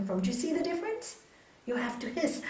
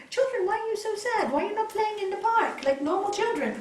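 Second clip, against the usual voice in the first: higher-pitched, animated questioning; storyteller's swami asking children, voice lifted high; concerned swami; 10 semitones higher, 4 dB louder than usual. Acted. Swami to sad children outside the park, high concerned questioning.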